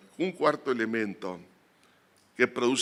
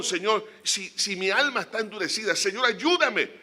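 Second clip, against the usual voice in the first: high, loud, fervent preaching; impassioned pulpit preaching, pressing and urgent; preaching at full force; 6 semitones higher, 3 dB louder than usual. Acted. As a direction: build the sermon to a passionate peak. Rising fervour on brokenness.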